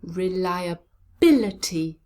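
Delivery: Reliability slow, with bil standing out; careful slow model of Reliability; slow blended word. Shown as a demonstration. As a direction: join the syllables of Reliability slowly, weight on bil. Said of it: "Now let's put those together".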